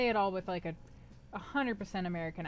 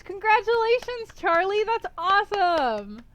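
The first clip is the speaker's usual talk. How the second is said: much higher and louder cheer, slowing on the last; excited congratulations; 11 semitones higher, 9 dB louder than usual. Real, not acted. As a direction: cheer a viewer for passing his test. Congratulating a chatter on passing.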